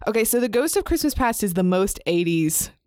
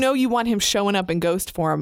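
usual speaking voice

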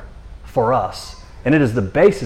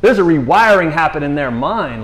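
ordinary talk, nothing marked

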